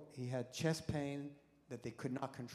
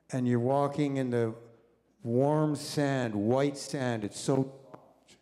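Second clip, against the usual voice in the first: relaxation tape; 8 dB louder, slower than usual. Retold or imitated.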